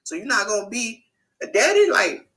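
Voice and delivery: mock outrage at the father; getting mad. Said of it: "I was sort of getting mad".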